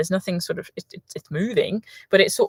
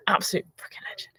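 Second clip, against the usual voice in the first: whispered aside; 8 dB quieter, faster than usual. Real, not acted. Aside about a passing friend.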